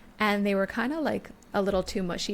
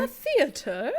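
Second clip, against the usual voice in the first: amused surprise; 6 semitones higher, 3 dB louder, slower than usual. Real, not acted. Amused disbelief at going out.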